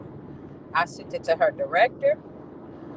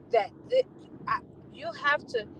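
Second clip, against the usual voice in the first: choked up; 5 dB quieter than usual. Real, not acted. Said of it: "I'm getting mad all over again".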